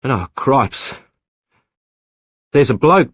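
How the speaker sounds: sudden alarmed outburst; startled. Acted. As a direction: blurt it out in alarm. Tom finds a man in boat.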